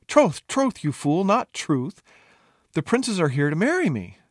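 high, loud impatience; exasperated correction. Acted. Princess explaining suitors to a dense fool, exasperated. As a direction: hammer it home, pitched well up.

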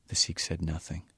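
ordinary delivery, nothing marked

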